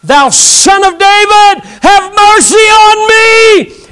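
long, high, loud shout for mercy; crying out. Acted. Blind man calling to Jesus as he passes, crying out. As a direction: cry out slowly at full voice, every word stretched. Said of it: "a blind man cries out and says".

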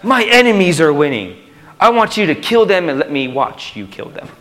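high, loud, anguished cry for help; desperate plea. Retold or imitated. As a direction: wail to God about the enemies. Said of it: "And next Psalm, it's".

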